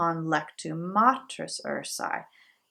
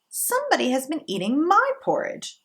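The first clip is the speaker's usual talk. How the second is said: higher mother bear voice; the middling mama bear, pitched up; mama bear in a higher middle voice; 8 semitones higher than usual. A narrator's reading. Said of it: "in her middle voice".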